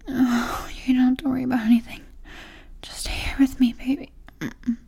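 ordinary talk, nothing marked

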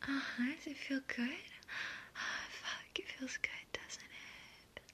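soft whisper, close to the listener; intimate whisper. Acted. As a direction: drop to a hushed whisper. Intimate roleplay, murmured to listener.

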